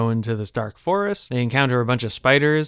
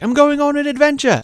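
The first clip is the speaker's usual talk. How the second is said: high, loud mock-excited cry; Bilbo; 5 dB louder than usual. Hobbit calling back as he runs off, elated high yell. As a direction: shout it gleefully in a put-on high voice. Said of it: "he's like".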